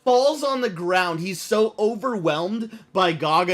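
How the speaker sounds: ordinary talk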